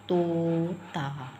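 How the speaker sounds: तोता very slow with a long ओ; drawn-out o. Shown as a demonstration. Teacher to class, slowly drawing out तोता. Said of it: "ओ की आवाज़ दे रहा है ना".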